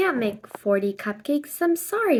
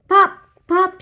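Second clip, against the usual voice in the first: loud, high popcorn pops; mimicking popcorn popping; popping sounds; 7 semitones higher, 9 dB louder than usual. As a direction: pop them out sharply.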